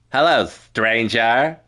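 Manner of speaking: slow, lilting stranger greeting, rising at the end; trial character voice. Shown as a demonstration. Streamer trying another voice for the stranger, slow lilting greeting. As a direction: draw it out with an upward lilt. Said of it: "Maybe that".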